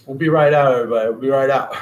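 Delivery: loud playful call to the crowd outside. Pretend. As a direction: call it out to the hallway. Calling out to people outside.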